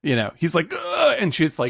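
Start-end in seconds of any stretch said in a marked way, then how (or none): 0.7-1.2 a high, strangled ugh of panic; high flustered groan. Retold or imitated. Podcaster voicing a trapped Benjamin: high panicked groan. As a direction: squeak out a cornered groan.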